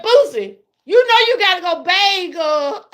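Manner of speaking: playful scolding call; mother scolding. Retold or imitated. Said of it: "I be saying".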